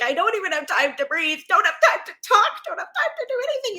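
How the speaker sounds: mock panic climbing steeply in pitch; panicked. Acting out someone in a panic state, mock-frantic. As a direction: rush into a high, hammed-up panic. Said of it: "panic state where you're like".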